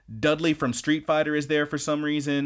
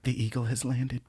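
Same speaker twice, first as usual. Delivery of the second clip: much softer, dreamy "The eagle has landed"; dazed hush; 7 dB quieter than usual. Retold or imitated. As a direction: breathe it out dazed and satisfied. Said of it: "she's just like".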